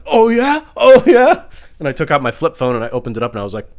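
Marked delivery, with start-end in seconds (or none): 0.1-1.5 loud, high mocking taunt; taunting challenge. Comic taunting a road-rager with a bat, loud and high. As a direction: dare the guy with the bat.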